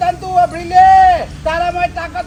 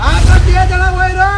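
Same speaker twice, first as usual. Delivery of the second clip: loud protest; angry shout; 7 dB louder than usual. Acted. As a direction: yell it back at them. Landlord outnumbered, shouting.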